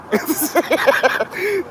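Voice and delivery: rising high laugh. Real, not acted. Laughs at the girls.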